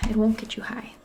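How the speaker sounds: normal talking voice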